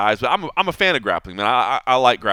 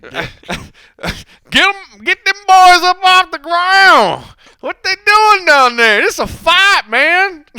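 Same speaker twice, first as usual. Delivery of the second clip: much higher, louder, slow country holler; hammed-up Southern accent; 10 dB louder, much slower than usual. Shown as a demonstration. Cohost asks again, hammed-up slow Southern yell. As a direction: drag out a yokel yell on request. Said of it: "Do it again".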